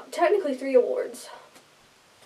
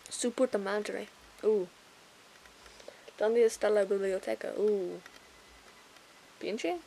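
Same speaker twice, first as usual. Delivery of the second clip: put-on Spanish accent; 3 semitones lower, 7 dB quieter than usual. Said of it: "How do I sound in Spanish".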